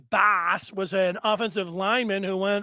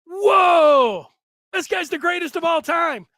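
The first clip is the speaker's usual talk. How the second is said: awed whoa; 7 semitones higher, 7 dB louder, slower than usual. Retold or imitated. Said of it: "I would just be standing there like".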